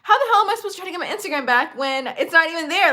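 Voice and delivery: exasperated rant. Retold or imitated. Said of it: "And I'm like".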